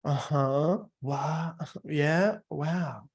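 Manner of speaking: lightly hammed eager interjections; fake-interested listener; mock eager listener. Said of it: "noise or body language, like".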